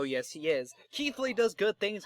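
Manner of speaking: normal talking voice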